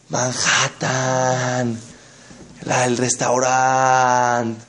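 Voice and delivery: very slow, drawn-out endings; imitated reverie, stretched out slowly; mock dreamy. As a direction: savour each word like a travel fantasy. Voicing dreamy fantasy of a trip.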